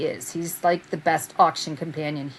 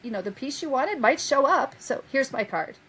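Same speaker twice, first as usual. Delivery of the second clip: a higher, sugary mock sales pitch; mocking impression; 7 semitones higher than usual. Mocking the rival handing out cards, a saccharine mock pitch. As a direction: parody her fake sweetness.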